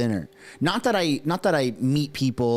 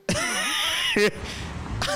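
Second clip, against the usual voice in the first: laugh. Real, not acted. Laughs at the fake CN Tower photo.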